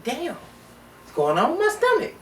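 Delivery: alarmed. Retold or imitated. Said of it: "And I'm like".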